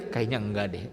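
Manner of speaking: a soft, doubtful inner voice; quiet inner voice. Pretend. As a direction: mutter it to yourself in doubt. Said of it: "Ibu aja ada yang menjawab di hati". (suara hati yang pelan dan ragu)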